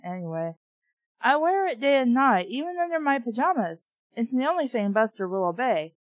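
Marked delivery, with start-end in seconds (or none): none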